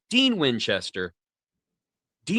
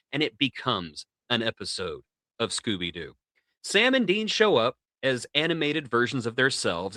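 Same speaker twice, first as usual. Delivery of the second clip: ordinary talk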